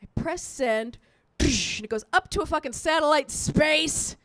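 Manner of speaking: ordinary talk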